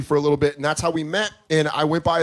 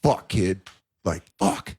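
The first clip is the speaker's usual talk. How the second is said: dad's regret; 7 semitones lower, slower than usual. Retold or imitated. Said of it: "looked at me in a way of".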